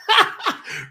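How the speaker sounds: high-pitched burst of laughter; real laugh. Real, not acted. Reacting to a chat comment, laughing.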